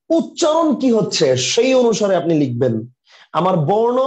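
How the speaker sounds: loud, insistent teaching voice; emphatic. Real, not acted. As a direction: drive the rule home loudly. Teacher hammering home the rule. (জোর গলায় বোঝানো)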